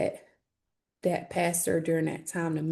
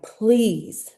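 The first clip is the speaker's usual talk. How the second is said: louder, slow begging "please help me"; pleading call for help; 4 semitones higher, 7 dB louder, much slower than usual. Retold or imitated. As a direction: beg the police for help, loud and slow. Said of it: "And I'm like".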